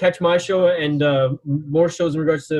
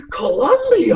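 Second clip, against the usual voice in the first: high, loud puzzled "Columbia?" like a clueless American; mock the producer mishearing the place; confused Columbia; 8 semitones higher, 4 dB louder, slower than usual. Retelling the call, caller imitates confused producer, high loud puzzled. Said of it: "he's like".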